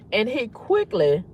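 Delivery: ordinary delivery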